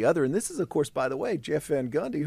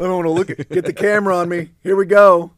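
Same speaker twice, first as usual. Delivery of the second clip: mock shout; 6 semitones higher, 12 dB louder than usual. Continues the mock promo.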